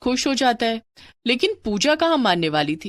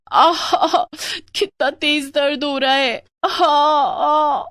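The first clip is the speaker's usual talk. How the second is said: higher, louder pained groans, slowly drawn out; wife crying out in pain at night; 6 semitones higher, 3 dB louder, much slower than usual. Acted. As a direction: moan aah aah as the cramps hit. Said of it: "पूजा दर्द के कारण चिल्लाने लगती है".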